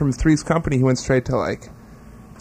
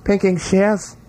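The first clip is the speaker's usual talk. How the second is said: higher-pitched mock British accent; 5 semitones higher, slower than usual. Hosts trading pinking shears, mock British accent. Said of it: "said in your best British accent".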